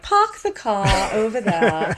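put-on slow, lofty mid-Atlantic accent on a taxi driver. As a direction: drawl it like a 1930s film star in mid-Atlantic accent. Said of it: "a taxi driver that's like".

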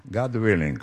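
plain reading voice

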